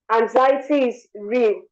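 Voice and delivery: normal talking voice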